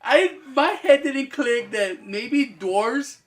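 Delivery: high, loud, indignant protest. Real, not acted. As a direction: defend yourself, loud and high. Defending himself, loud and high.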